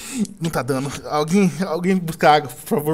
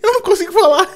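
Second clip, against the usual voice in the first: whiny near tears; 12 semitones higher, 3 dB louder than usual. Real, not acted. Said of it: "eu vou chorar".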